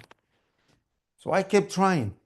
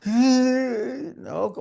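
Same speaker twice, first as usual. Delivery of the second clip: high-pitched imitated wail; mock crying; 10 semitones higher, 4 dB louder than usual. Son imitating mom crying again, high mock wail. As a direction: burst into a put-on wail. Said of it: "started crying again".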